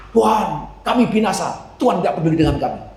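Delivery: mock cry of despair to God. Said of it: "kita tidak boleh teriak".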